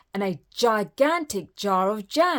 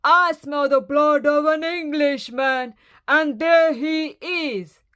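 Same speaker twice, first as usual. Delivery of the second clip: loud, raised, hunting giant; giant's roar; 7 semitones higher, 6 dB louder than usual. A narrator's reading. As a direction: bellow it, stretching the last words. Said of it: "roared the giant".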